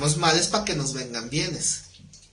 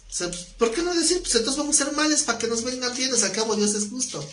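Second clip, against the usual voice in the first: sarcastic; 8 semitones higher than usual. Shown as a demonstration. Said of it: "Ironía. O, para los cuates, sarcasmo".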